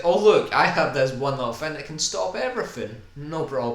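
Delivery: high, loud smug hero voice; a loud, mocking take on the hero's smug confidence; smug mock Doctor. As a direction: lord it over everyone, smugly. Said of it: "It's like".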